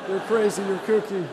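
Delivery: wag a finger at the pranksters, playfully loud; mock scolding.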